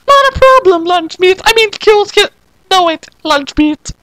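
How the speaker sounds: sweet fake girlish greeting; high girl voice. Madoka disguised as Sayaka greeting Kyosuke, high girl voice.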